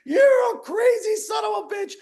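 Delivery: the king erupts at his advisor over his lost finger, shouting; furious king. Retold or imitated. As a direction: yell at him like an enraged king. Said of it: "The king's like".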